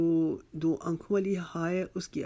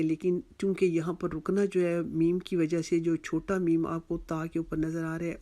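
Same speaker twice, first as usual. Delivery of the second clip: normal talking voice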